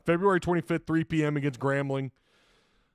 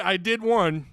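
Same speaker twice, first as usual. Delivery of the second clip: ordinary delivery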